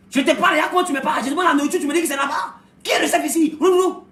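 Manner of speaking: loud, fast domineering yell; angry husband. Acted. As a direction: bark it like a bossy brute. Brutish husband yelling at wife.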